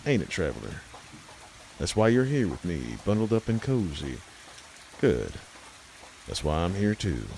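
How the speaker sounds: plain reading voice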